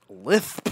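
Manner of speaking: "Lisp" said as lithp; showing the lisp on its own name. Shown as a demonstration. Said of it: "They can't even say it".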